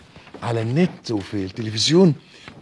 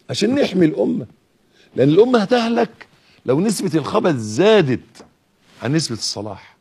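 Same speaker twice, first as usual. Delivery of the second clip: high, loud impassioned sermon call; fiery preacher, louder and higher; preaching at full force; 6 semitones higher, 5 dB louder than usual. Acted. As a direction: rouse the whole congregation to act now. Preacher rallies the congregation.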